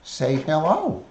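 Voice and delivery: coaxing pet voice. Acted. Coaxing parrot again.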